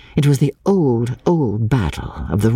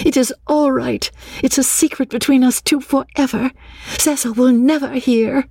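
longing to shout; 12 semitones higher than usual. A narrator's reading. Lucy glad of her secret, longing to shout. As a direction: exult high up without raising volume. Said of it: "She longed to shout the words".

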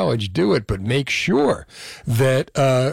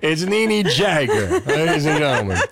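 laughing. Real, not acted. Introducing guest through laughter.

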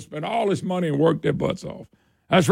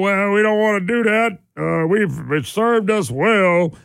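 mock politician excuse; slower than usual. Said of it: "we're being told".

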